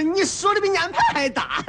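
high, laughing brush-off. Acted. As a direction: chuckle away the blame. Laughs off the accusation.

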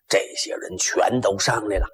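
usual speaking voice

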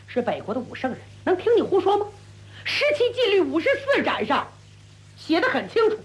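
ordinary delivery

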